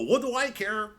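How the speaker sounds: hoch, laut, übertrieben lässig; gleichgültiger Komiker. Pretend. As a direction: ein Schulterzucken in der Stimme, völlig egal. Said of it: "You're supposed to go".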